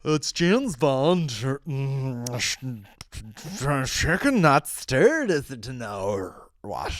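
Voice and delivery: slow, hammed-up James Bond impression; garbled James Bond. Host lampooning the actor's accent, slow garbled Bond voice. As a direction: drag the words out into a slurred mock Bond.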